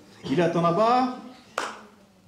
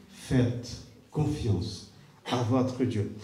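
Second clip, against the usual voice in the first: very slow and soft, each word weighed; slow hushed solemn delivery; 6 semitones lower, 4 dB quieter, much slower than usual. Shown as a demonstration. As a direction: let it sink in slowly. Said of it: "repeated phrase, slowest take".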